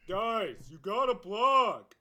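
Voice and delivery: loud, slow droning coach; monotone coach voice. Retold or imitated. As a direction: drone the order out slowly and loudly. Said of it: "He had the most monotone voice ever".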